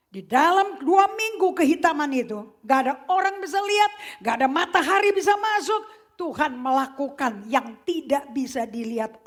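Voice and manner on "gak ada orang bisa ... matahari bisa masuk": ordinary talk, nothing marked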